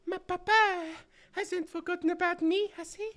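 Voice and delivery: put-on high, girlish, anxious noblewoman; young lady voice. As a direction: ask about papa like a worried girl.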